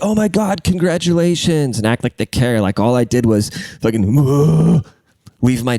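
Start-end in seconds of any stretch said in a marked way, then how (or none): 0.0-1.8 high gushing "oh my God, congratulations"; mocking everyone's fake joy; mock gushing congrats. 4.1-4.8 loud high "whoa" exclamation; a silly exaggerated whoa; hammed-up moan of surprise; mock whoa. Mock sex noise.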